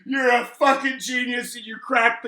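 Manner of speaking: excited. Real, not acted. Excited praise, shouting.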